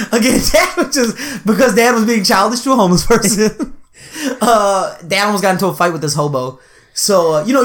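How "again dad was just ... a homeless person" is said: higher voice breaking into laughter; laughing. Real, not acted. Explaining dad's childish prank to co-host, laughing. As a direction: talk through the giggles.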